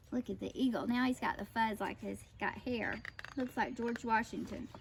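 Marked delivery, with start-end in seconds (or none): none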